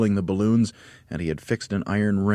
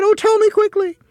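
very high, loud panicky cry; Missus Twit; 10 dB louder than usual. A narrator's reading. Wife asking how long she has left, high panicked cry. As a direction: wail it high in alarm. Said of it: "she cried".

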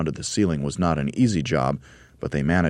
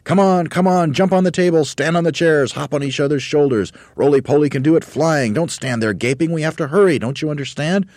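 high, loud rapid-fire urging; frenzied excitement; 10 semitones higher, 7 dB louder than usual. A narrator's reading. Monkey leader hurrying everyone, frenzied. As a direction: goad them on in a frantic raised voice. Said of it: "He was in a frenzy of excitement".

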